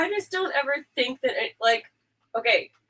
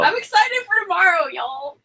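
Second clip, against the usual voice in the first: high, loud gushing; squealing with anticipation; excited; 9 semitones higher, 11 dB louder than usual. Real, not acted. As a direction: burst out with eager excitement. Gushing about tomorrow's shoot.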